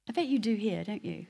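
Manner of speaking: ordinary talk, nothing marked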